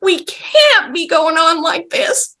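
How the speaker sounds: slow, high whiny imitation; half whiny crying. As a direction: drag it out in a mock tearful whine. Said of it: "sort of half whiny crying".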